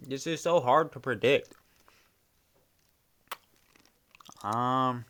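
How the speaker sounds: talking while chewing ice; crunching ice. Real, not acted. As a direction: crunch ice between the words. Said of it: "sorry for my crunching of ice".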